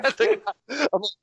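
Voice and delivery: usual speaking voice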